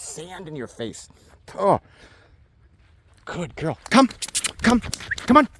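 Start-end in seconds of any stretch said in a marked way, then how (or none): none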